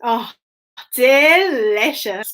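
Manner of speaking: ordinary delivery, nothing marked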